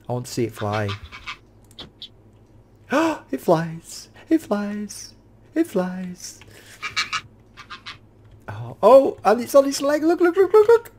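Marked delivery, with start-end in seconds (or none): 2.9-6.4 high, soft awed repetition. Real, not acted. Player watching heron take off, high and soft in awe. 8.5-10.9 very high, rising excited squeals; squealing excitement. Real, not acted. Player squealing at heron lifting its leg, very high.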